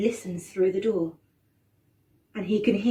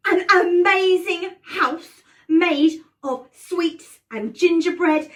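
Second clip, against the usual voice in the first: louder, higher, slow wonder; amazed gasp; 7 semitones higher, 8 dB louder, slower than usual. A narrator's reading. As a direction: marvel at it, drawn out. Gasp at candy house.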